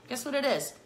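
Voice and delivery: ordinary talk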